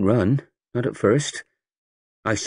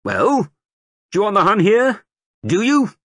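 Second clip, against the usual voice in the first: loud, high recruiting sergeant rousing a crowd; sergeant major; 11 semitones higher, 4 dB louder than usual. A narrator's reading. Sergeant major rousing the market crowd, booming. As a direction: bellow it to the whole square like a drill sergeant.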